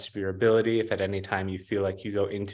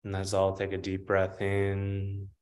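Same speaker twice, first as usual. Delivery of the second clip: guided meditation; 3 dB quieter than usual. Acted. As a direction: guide them gently, unhurried, long pauses between phrases. Leads a grounding meditation.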